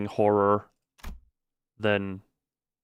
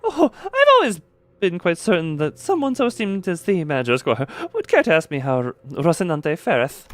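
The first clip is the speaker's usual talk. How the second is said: put-on high, grand, old-fashioned knightly speech; lofty knight voice; 6 semitones higher, 4 dB louder than usual. Streamer voicing Don Quixote's lines to Squire in knightly voice. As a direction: play the proud knight, chest out.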